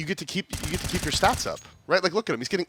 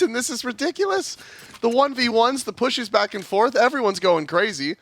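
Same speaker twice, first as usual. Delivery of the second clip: esports caster; 6 semitones higher than usual. Real, not acted. Live play-by-play climax.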